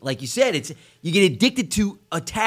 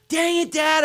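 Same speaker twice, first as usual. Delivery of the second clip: put-on higher, whiny kid missing the kiss; frustrated daughter; 12 semitones higher, slower than usual. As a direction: pout it out at dad. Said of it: "She'd be like".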